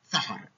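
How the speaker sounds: put-on ثحر with th instead of s, like a child; lisping child saying ثحر for سحر. Shown as a demonstration. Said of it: "قول يا ابني سحر".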